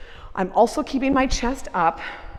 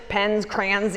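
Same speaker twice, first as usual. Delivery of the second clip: usual speaking voice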